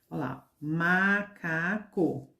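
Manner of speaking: macaco stretched slowly, each syllable clear; slow syllable demo. Shown as a demonstration. Teacher showing children macaco slowly. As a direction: lay the word out for small kids. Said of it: "Qual outra palavra que tem três sílabas?".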